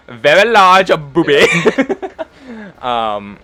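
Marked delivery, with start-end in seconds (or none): 1.5-2.8 high, loud laugh; the GM cracks up at the table; burst of laughter. Real, not acted. GM laughs at player joke.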